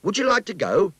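put-on bright eager child asking; boy's voice. A narrator's reading. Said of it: "Skeets asked".

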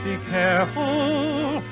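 ordinary talk

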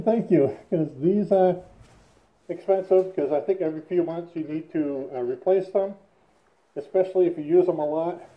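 muffled behind half-face respirator; speaking inside filter mask; through a respirator mask. Real, not acted. Seen 2: Respirator covers mouth and nose until pulled down near 14.5s.

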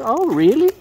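loud high oh really; playful surprise. Real, not acted. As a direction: tease the bird, surprised. Talking to pet birds.

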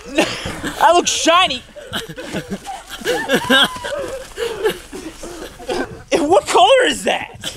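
high, slow words between big laughs; laughing. Real, not acted. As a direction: crack up while talking. Covered in egg, laughing.

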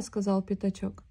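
ordinary delivery

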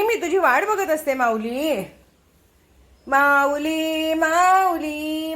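high, tender coaxing; fond pet talk. Real, not acted. Telling the cat she waited for it, tender high pet talk. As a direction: dote on the cat.

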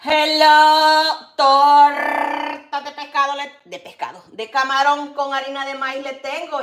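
loud, high drawn-out vendor cry; loud sing-song vendor call; street vendor call. Acted. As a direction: stretch the words slowly like a market seller hawking.

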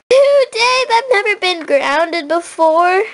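two days said as a shocked high squeak. Acted. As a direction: gasp it out in outrage. Shocked at being grounded.

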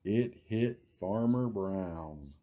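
lively, sing-song storybook read; reading to children. Acted. Reading a picture book aloud to young viewers, sing-song. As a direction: build each chain link like a bedtime story.

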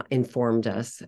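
ordinary talk, nothing marked

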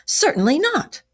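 high-pitched, indignant refusal; pitched well up, emphatic no. A narrator's reading. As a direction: refuse point-blank as a story character. Toad refusing to promise.

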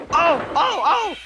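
very high yelps of pain; pained cry. A narrator's reading. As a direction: yelp shrilly as if struck. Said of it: "cried the van".

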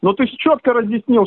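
ordinary talk

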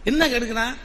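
usual speaking voice

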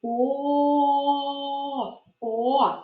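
"О" held very long on a level note, then repeated shorter; teacher drawing out the sound О; stretched vowel. Shown as a demonstration. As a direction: sustain a steady o. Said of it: "Визначимо другий звук".

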